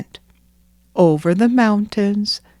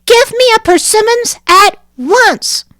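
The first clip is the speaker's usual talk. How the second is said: put-on high, loud, fierce child's command; fierce little girl; 13 semitones higher, 7 dB louder than usual. Nanina demanding from the chief, high loud fierce child. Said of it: "said Nanina fiercely".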